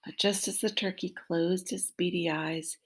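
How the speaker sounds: ordinary delivery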